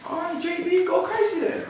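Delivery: high mocking voice, egging him on; mock encouragement. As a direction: egg him on in a high teasing voice. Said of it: "I'm like".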